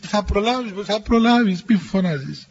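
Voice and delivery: usual speaking voice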